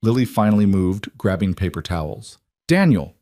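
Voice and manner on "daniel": higher, louder sharp cry of his name; alarmed call. Acted. Lily calls out in alarm.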